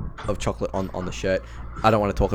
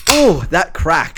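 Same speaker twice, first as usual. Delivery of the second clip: satisfied groan; 6 semitones higher, 6 dB louder, much slower than usual. Real, not acted. Satisfied sigh after opening drink.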